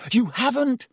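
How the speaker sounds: high, mildly yelled protest. A narrator's reading. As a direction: contradict him in a restrained yell. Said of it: "Harry yelled".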